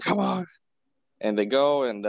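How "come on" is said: higher-pitched mock plea; urging Pepper. Pepper answers Rhodey's refusal, mock urging. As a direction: coax insistently in a raised pitch.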